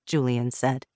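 usual speaking voice

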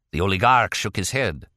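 ordinary delivery

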